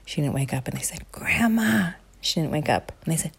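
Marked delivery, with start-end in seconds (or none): 1.1-1.9 whisper. Acted. Whispered test.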